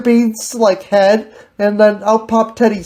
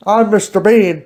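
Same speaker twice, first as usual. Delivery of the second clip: ordinary talk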